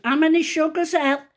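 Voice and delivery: re-enacted calling out; retold shout. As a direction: call it out to someone hard of hearing. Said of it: "I shouted".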